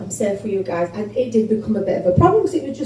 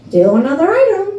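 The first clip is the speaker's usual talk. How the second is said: put-on American accent; 6 semitones higher, 5 dB louder than usual. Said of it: "speaking to me in an American accent".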